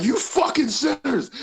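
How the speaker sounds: high, drawn-out condemnation; mock righteous fury, slowly stretched; wrathful Jesus. Hallucinated Jesus chasing stoned kids, mock wrath.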